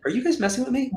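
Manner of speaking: high, soft disbelieving question; incredulous. Retold or imitated. Teen daughter to mom and aunt, incredulous and high. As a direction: sound baffled, as if being tricked. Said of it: "And I'm like".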